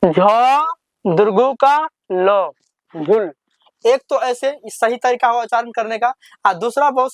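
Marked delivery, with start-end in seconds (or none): 0.1-3.3 झ दुर्गु का ल झुल read syllable by syllable, very slow; very slow demo. Shown as a demonstration. Teacher to pupils, slow spelled-out demonstration. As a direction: spell out झुल slowly with a pause before the last part. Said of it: "फिर से एक बार सीख लीजिए".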